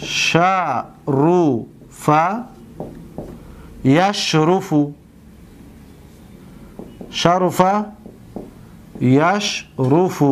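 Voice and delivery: drawn-out syllables with long pauses; unhurried word-by-word dictation; slow dictation. Shown as a demonstration. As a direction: say it piece by piece as you write. Dictating example while writing it.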